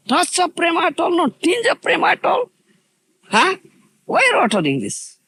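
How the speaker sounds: high-pitched, emphatic reproach; indignant, high and insistent; impassioned scolding. Real, not acted. Scolding followers for hollow chanting.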